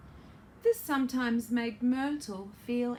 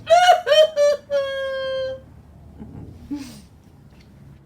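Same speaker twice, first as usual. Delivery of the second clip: loud mock wail; 12 semitones higher, 13 dB louder than usual. Acting out bursting into tears.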